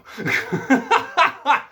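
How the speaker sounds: high, loud cackling laugh; real high-pitched laughing fit. Real, not acted. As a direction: burst out laughing in high, repeated peals. Laughs at own impression.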